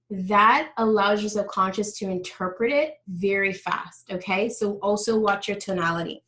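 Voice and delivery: ordinary talk, nothing marked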